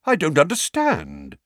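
high, loud bewilderment; greatly surprised. A narrator's reading. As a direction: voice real puzzlement, astonished. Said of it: "in a tone of great surprise".

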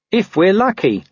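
plain reading voice

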